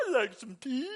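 lightly voiced goofy cartoon puma; a hint of a daffy cartoon cat; Pete Puma voice. Preacher voicing Pete Puma for the congregation, mock goofy. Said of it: "And Pete Puma says".

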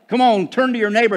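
usual speaking voice

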